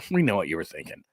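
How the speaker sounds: low, soft knowing reply; hushed imitation of a knowing crowd; mock knowing aside. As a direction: drop it low like a sly aside. Imagined knowing public reply.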